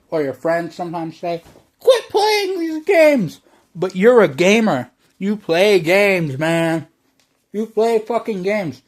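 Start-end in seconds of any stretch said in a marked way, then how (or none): 1.8-3.4 high, slow mock nag of a relative; nagging relative. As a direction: mimic a scolding aunt, stretched out. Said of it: "your relatives sometimes say".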